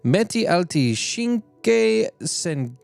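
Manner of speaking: hammed-up Italian accent, pitched high. Streamer attempting Italian card text, in an Italian accent. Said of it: "I'm doing this accent".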